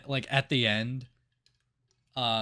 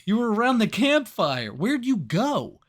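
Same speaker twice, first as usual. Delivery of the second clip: questions that climb higher and louder in disbelief; confused mock panic; 8 semitones higher, 6 dB louder than usual. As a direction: build the confused shouting toward the last question. Panicked questions inside the dream scene.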